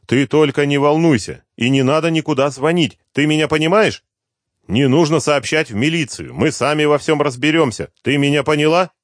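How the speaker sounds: high, loud, pressing reassurance; calm her down while frightened yourself; urgent reassurance. Acted. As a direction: son urgently calming his panicked mother. Hero instructing mother.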